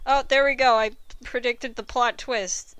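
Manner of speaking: ordinary talk, nothing marked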